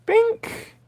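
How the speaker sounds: high squeaky bink; camera click noise. Real, not acted. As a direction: chirp a camera sound. Camera shutter noise imitation.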